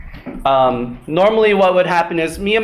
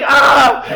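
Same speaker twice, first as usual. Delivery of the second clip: loud, higher mock shout of alarm; startled yell; 8 semitones higher, 7 dB louder than usual. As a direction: yelp caught in the act. Brother sees mom.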